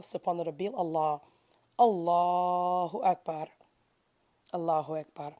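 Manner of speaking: murmured devotional chant, repeated evenly; prostration prayer. Real, not acted. Reciter pausing for prostration, murmured prayer.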